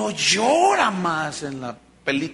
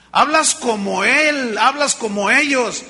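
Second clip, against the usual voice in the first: louder, slow pointed accusation; accusing; 4 semitones higher, 5 dB louder than usual. Acted. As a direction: drive the point home to Pedro. Accuser presses Peter.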